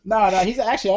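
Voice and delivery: usual speaking voice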